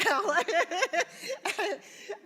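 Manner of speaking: loud, high burst of laughter; real laughter. Real, not acted. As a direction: burst out laughing mid-talk. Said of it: "I'm laughing because I'm looking".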